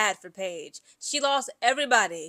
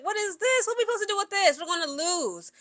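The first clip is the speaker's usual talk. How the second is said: baffled players; 7 semitones higher than usual. Retold or imitated. Players baffled by the coach's plays.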